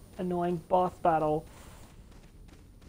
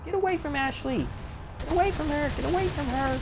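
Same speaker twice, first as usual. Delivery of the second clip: high, urgent shouts at the enemy; real protective yelling; 9 semitones higher than usual. Real, not acted. Gamer shouting at the boss grabbing Ashley, urgent yell. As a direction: yell to drive him off her.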